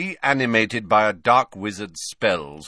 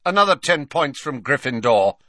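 cold; 5 semitones higher, 6 dB louder than usual. A narrator's reading. Snape punishes Ron.

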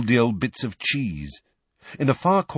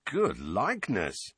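softer, slow deadpan quip, grinning; grinning dry remark; 6 dB quieter, slower than usual. Acted. Fred teases Harry about Dobby's painting, grinning deadpan. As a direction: let the joke sit, dry and slow.

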